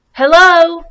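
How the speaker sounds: loud, high call across water. Acted. Little Beaver calls across the pond, loud and high. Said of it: "said the voice from across the pond".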